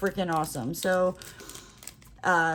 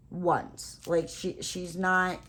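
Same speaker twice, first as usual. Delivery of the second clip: ordinary talk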